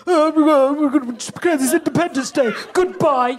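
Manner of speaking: put-on Cockney accent; mock a politician's proclamation in exaggerated Cockney; Nigel Farage. Comic mocking a departing politician, put-on Cockney. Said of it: "He's in a fucking Cockney".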